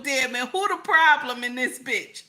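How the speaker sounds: ordinary delivery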